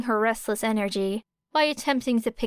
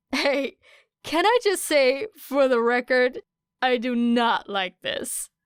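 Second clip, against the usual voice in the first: laugh in his voice; 3 semitones higher, 4 dB louder than usual. A narrator's reading. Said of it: "unable to mask the laugh".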